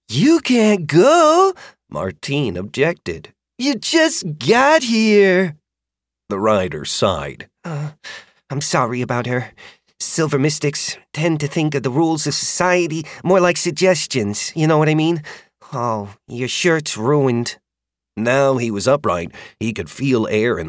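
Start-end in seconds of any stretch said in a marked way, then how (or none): 0.1-5.6 slow, high creature voice, delighted; a dragon proud of her find, drawn out slowly; happy dragon. A narrator's reading. Dragon explaining her find to her rider, slow and pleased. 7.6-17.6 put-on higher girl's voice, sheepish; a young rider smoothing things over; apologetic rider. A narrator's reading. Rider apologizing to the boy for her dragon, sheepish.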